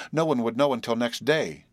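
ordinary delivery

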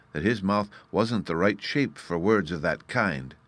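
plain reading voice

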